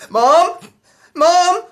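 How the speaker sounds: high, loud frightened cry for Mom; panicked call. Acted. Boy calling Mom as his sister chokes, panicked. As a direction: scream for help in panic.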